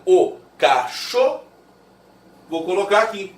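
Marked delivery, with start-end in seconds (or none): 0.0-1.4 "O cachô" slowed right down, closed ô held. Shown as a demonstration. Teacher repeating the closed ô for the class. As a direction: stretch "cachô" to show the closed o. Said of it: "esse O é fechado".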